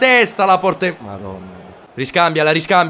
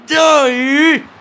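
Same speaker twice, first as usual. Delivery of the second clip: shouting; 6 semitones higher than usual. Real, not acted. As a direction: yell it at the screen. Urging his player to score.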